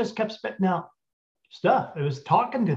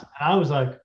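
normal talking voice

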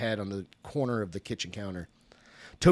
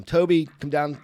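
calling up the stairs, raised voice; 6 semitones higher, 5 dB louder than usual. A narrator's reading. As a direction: call him down from upstairs. Mother calls upstairs.

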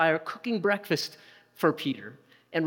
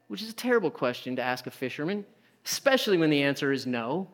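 ordinary talk, nothing marked

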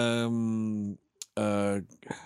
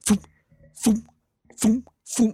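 rising, louder foom zaps, like guys vaporized; mock zap sounds; 12 semitones higher, 7 dB louder, slower than usual. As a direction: fire off each foom like an alien ray. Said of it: "there's guys all around him going".

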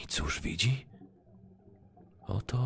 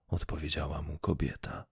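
usual speaking voice

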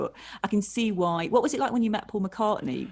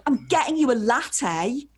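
higher and louder, pushy mock aggression; aggressive insistence; 6 semitones higher, 4 dB louder than usual. Nervous fan insisting to Jim Bob, mock aggressive. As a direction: force the latte on him, mock aggressive. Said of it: "Like, really aggressively".

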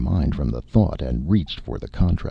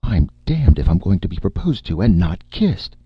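higher, louder indignation; peeved and emphatic; somewhat irritated; 6 semitones higher, 5 dB louder than usual. A narrator's reading. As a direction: protest with mounting annoyance. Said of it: "said in a somewhat irritated tone".

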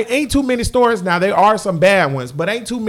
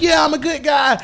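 wrestler hype; 6 semitones higher than usual. Retold or imitated. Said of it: "he's like".

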